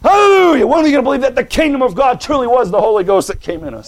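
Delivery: exultant pulpit cry; fired-up preaching. Acted. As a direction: burst out high and loud, then drive on. Preaches at full force.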